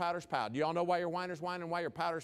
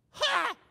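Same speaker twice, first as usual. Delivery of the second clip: loud, very high "ha!"; sharp high cry of mock outrage; mock outraged gasp; 9 dB louder than usual. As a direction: burst out in hammed-up shock. Mock outrage at someone's parking offence.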